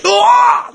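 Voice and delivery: high mock cry of amazement; hammed-up high "aah"; acted excited yell. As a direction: yelp like the download just landed. Reenacts his reaction to the download.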